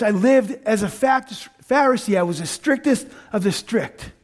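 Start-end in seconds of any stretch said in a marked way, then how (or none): none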